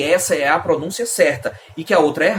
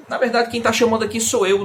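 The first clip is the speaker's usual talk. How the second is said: ordinary talk, nothing marked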